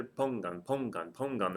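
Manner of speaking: pongan repeated with stress on PON; pongan, stressed on the O each time. Shown as a demonstration. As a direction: lean on the first syllable of pongan. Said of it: "The stress is over the O".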